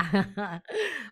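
a short scornful laugh at school; mocking laugh. Lucignolo laughing at Pinocchio's school plans, mocking laugh.

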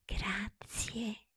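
a tiny breathed whisper. Acted. Pinocchio hugging Mangiafuoco, whispered thanks. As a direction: breathe the thanks into his ear. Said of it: "sussurrandogli un piccolo".

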